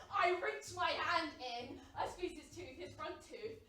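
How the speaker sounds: ordinary talk, nothing marked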